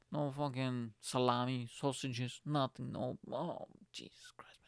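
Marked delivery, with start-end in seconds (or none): 3.9-4.6 hushed, horrified whisper. Acted. Horrified at a year without bacon, whispered. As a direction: breathe it out in dismay.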